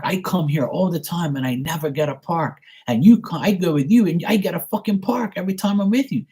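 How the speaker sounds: imitating a furious sister griping; pissed-off sister. Sister ranting that he always gets a parking spot, high and exasperated. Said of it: "she's so pissed".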